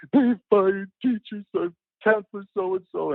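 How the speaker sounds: put-on high, slow upset child voice; crying kid. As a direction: sound like a small boy too upset to speak.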